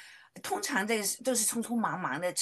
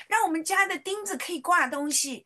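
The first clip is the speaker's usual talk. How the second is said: put-on a higher, childlike prayer voice; child praying; 8 semitones higher, 5 dB louder than usual. Little daughter praying in Sunday school, high childlike voice. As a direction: sound like a little girl praying. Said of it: "他就祷告说".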